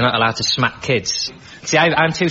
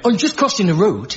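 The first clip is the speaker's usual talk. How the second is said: put-on Birmingham kid; 8 semitones higher than usual. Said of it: "were from Birmingham".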